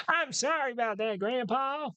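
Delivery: put-on high, strained old man voice; grandpa voice. Shown as a demonstration. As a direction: quaver it out like a frail grandpa. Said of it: "that's the grandpa voice".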